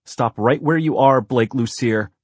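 stern, forbidding big-sister bark; forbidding command. A narrator's reading. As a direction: halt a child with a must-be-obeyed order. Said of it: "she who must be obeyed voice at its most forbidding".

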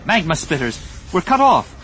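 high, loud battle warning; shouted alarm across a firefight. Acted. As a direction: call out the danger urgently.

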